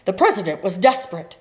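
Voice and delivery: lively read-aloud narration; story narration. A narrator's reading. Teacher reading a picture book to students, lively narration.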